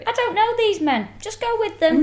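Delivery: very high put-on voice; squeaky pretend protest, played for laughs; mock protest. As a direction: act it out in a silly high voice. Mockingly acting out the handover.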